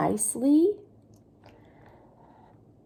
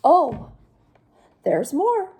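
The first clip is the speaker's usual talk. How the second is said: ordinary delivery, nothing marked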